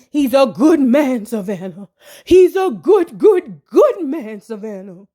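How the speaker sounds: slow, drawn-out mock melodrama; mock-dramatic quote. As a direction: stretch it out like an overwrought movie line. Dramatic quoted line to Savannah.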